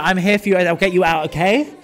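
ordinary talk

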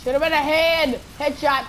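high, loud triumphant call; excited shout. Real, not acted. Gamer celebrating a shot to the head, excited shouting. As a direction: holler the headshot out. Calling out his shot in game.

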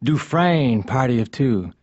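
ordinary delivery

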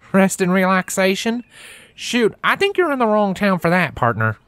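high, amused country drawl for Applejack, teasing; a country mare teasing the newcomer with a twangy drawl; charming country drawl. Acted. Country mare teasing a tired newcomer, in a high country drawl. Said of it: "with a charming country drawl".

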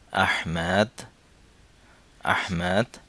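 ordinary delivery

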